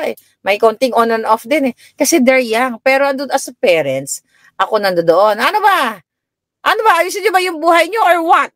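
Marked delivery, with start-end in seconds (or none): 5.4-8.5 high-pitched exasperated scolding; scolding mother. Retold or imitated. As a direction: nag a mother telling her kids to sort out their lives. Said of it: "Sabi ko nang ganon". (pagalit na sermon ng nanay)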